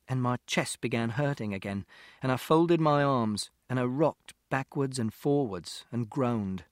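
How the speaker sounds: usual speaking voice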